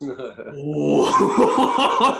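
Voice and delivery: real laugh through the word. Real, not acted. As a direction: laugh it out in disbelief. Reacting to the sudden hang-up.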